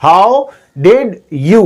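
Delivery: "how did you" very slow, one word at a time; three separate syllables. Shown as a demonstration. Teacher counting out three syllables, slow and separate. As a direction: stretch out "how did you" with gaps between words. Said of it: "three syllable".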